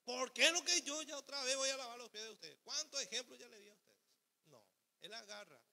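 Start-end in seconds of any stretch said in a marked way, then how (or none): 0.0-4.0 mock complaint. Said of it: "Imagínense si Jesús dijera esto".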